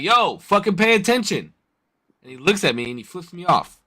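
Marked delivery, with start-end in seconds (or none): 0.0-1.5 sharp shout across the street; calling out. Retold or imitated. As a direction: yell at the distracted driver. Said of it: "So I'm like".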